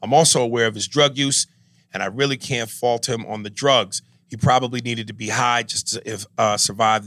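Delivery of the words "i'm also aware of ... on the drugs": ordinary talk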